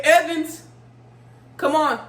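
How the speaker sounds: loud, high call; guard calling an inmate over, loud. Retold or imitated. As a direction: summon her impatiently from afar. Guard calling her name.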